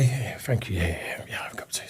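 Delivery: a whispered yes to the offered tea; whispering. Retold or imitated. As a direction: hush it, embarrassed in a silent room. Quiet reply in crowded meeting room.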